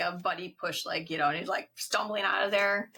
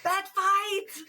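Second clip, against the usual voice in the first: high excited mock yell, pitched way up; 13 semitones higher, 3 dB louder than usual. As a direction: cry out bat fight like a kid at a brawl. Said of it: "Vlad yells".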